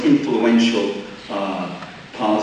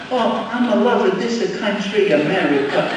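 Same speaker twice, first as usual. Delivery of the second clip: higher, overdone fake Italian accent; Luigi's fake Italian accent; 8 semitones higher than usual. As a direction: ham up a movie Italian accent like an Irish actor would. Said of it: "no Italian had an accent like that".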